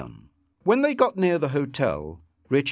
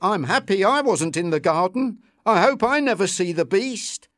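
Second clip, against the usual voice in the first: nervous; 8 semitones higher, 5 dB louder than usual. A narrator's reading. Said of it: "Bill told Lucy nervously".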